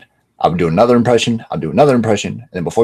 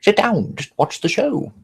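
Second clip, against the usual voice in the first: high-pitched mock audience voice; high put-on voice for a quoted exchange; imitating latecomers; 10 semitones higher than usual. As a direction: act out latecomers, pitched well up. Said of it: "people who come in late, they're just gonna be like".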